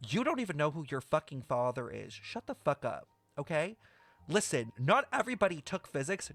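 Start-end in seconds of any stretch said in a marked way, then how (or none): none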